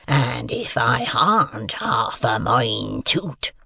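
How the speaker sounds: slow, husky, drawn-out menace; threatening convict. A narrator's reading. Convict threatens to eat the boy, slow and threatening. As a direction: rasp it slowly as a threat. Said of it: "with a threatening shake of his head".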